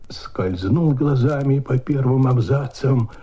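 usual speaking voice

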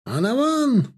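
very high, stretched battle call; rally the column with a high cry; commander's cry. A narrator's reading. French commander urging his column on, high cry. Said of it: "раздался всё тот же командирский".

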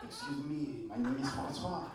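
put-on low, soft French accent. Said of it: "with a fucking French accent".

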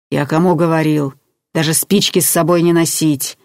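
usual speaking voice

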